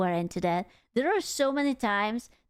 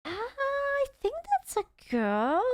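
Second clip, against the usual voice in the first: surprised; 12 semitones higher, slower than usual. Real, not acted. Hearing the voice note.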